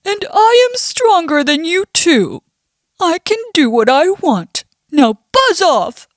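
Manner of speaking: puffed-up storybook bear bragging; annoyed bear. Acted. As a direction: dismiss the bee with bluster. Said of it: "Mister Bear is annoyed".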